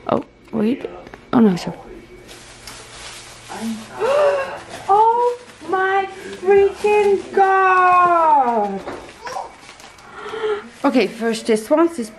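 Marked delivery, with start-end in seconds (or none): 3.5-4.8 high, sharp gasp of amazement; big delighted intake of breath; astonished gasp. Real, not acted. Astonished at opened gift. 4.9-8.8 very slow, drawn-out, high exclamation; astonished, long high wail of delight; overwhelmed amazement. Real, not acted. Astonished at opened gift.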